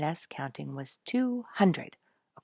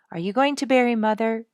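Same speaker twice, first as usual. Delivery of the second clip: frightened; 8 semitones higher, 12 dB louder, faster than usual. A narrator's reading. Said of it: "with a little frightened tone".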